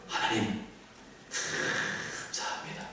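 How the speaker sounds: hushed, low acted prayer of gratitude; soft, reverent murmur to God; heartfelt thanks. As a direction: let thanks out almost on a breath. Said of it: "믿으면 무엇이 터져나옵니까?".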